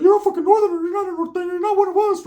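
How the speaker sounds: very high, squeaky mock voice; high cartoon voice imitation; Ricky's voice.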